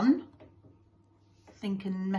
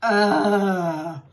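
loud, long bored groan; 7 dB louder than usual. Acted. Whole school hears the family gush, loud groan. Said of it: "the whole school groaned".

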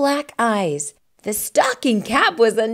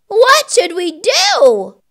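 put-on high, loud, worried children; children crying out; 8 semitones higher, 6 dB louder than usual. A narrator's reading. Children calling to the melted snowman, high and worried. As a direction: call out like alarmed kids. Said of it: "The children cried out".

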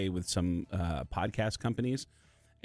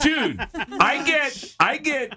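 much higher and louder, amazed; excited; 7 dB louder, slower than usual. Real, not acted. Boasting to the hosts about band emojis, loud and amazed. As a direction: burst out with it.

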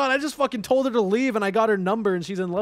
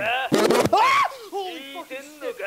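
much higher startled yell; real startled shout; 8 semitones higher than usual. Real, not acted. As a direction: jump out of your skin. Startled by jumpscare.

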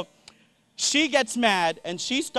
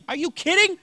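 pissed uncle; 8 semitones higher than usual. Retold or imitated. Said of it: "my uncle is pissed now".

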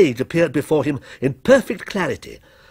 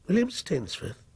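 soft, questioning "William Stainsforth"; baffled echo; 3 semitones higher, 9 dB quieter than usual. A narrator's reading. Author repeats the stranger's name, baffled soft echo. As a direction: echo "William Stainsforth" under your breath, puzzled.

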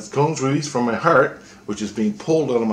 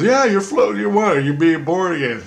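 very high, louder reassurance; high explaining voice; 9 semitones higher, 4 dB louder than usual. Retold or imitated. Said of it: "and he goes".

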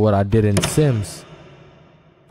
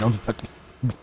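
mock stern voice; 7 dB quieter, slower than usual. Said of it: "after I said".

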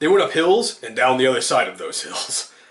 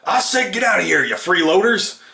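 high, raised holler at freeloaders; farmer's shout; 8 semitones higher, 4 dB louder than usual. A narrator's reading. Farmer ordering freeloaders out of his barn, shouted. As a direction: shout the order, pitched well up. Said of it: "the farmer shouted again".

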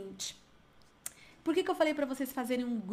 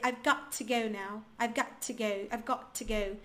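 British accent; 2 semitones lower than usual. Shown as a demonstration. Teacher showing what a Briton would normally say, British accent. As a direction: demonstrate careful British English, t fully sounded. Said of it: "Agora, um britânico".

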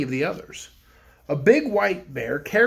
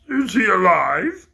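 frogman; 5 dB louder, slower than usual. Acted. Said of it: "asked the frogman".

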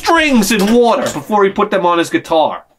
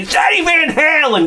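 retold shout; 11 semitones higher than usual. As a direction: yell it at your wife in disbelief. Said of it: "I'm like".

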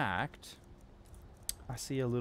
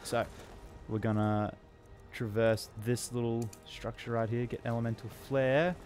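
ordinary talk, nothing marked

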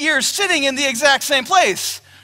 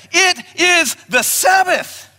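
stern declaration; 6 semitones higher, slower than usual. Retold or imitated. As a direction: lay down the law, every word heavy. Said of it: "This is what they say".

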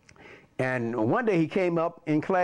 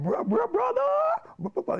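high, strained stammering principal voice; put-on high-pitched principal; imitating the principal. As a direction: stutter into the word in a high mock voice. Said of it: "he kinda talked".

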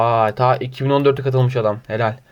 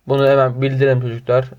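ordinary talk

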